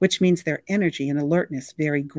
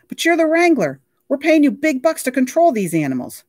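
much higher, louder indignant complaint; whiny outrage; 11 semitones higher, 8 dB louder than usual. Acted. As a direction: whine at the hired help. Derek blames the wrangler.

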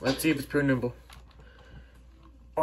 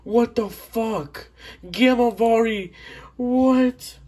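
loud, much higher, thrilled yelling; ecstatic shouting; 9 semitones higher, 4 dB louder than usual. Real, not acted. Gamer finding a rare Gamma Vari, ecstatic yelling. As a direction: shout in real disbelief and joy.

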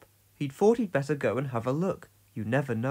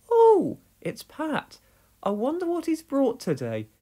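Dorothy voice; 11 semitones higher than usual. A narrator's reading. Dorothy sees Pat coming into the yard, cheery. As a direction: greet the postman with a cheery lilt.

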